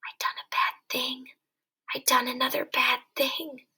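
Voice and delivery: soft breathless whisper of fright; frightened whisper. Acted. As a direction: whisper it in fear. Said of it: "he whispered in fright".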